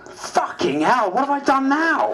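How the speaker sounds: high, slow, half-laughing dismay; alarmed outburst. A narrator's reading. MP startled by a note summoning her to the Prime Minister. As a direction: gasp at the summons, then a nervous laugh.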